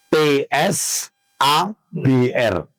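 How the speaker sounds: T S A B R spelt slowly, letter by letter; spelling it out. Shown as a demonstration. Preacher spelling the name for the audience, slow letters. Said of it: "Jadi pakai sa".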